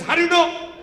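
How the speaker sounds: loud high indignant yell; upset shout. Retold or imitated. Manager outraged that the secret leaked, shouting. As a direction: demand it, outraged. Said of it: "He was so upset".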